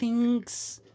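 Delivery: high drawn thanks; mock girly thanks. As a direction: imitate the girl's thanks. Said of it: "she goes".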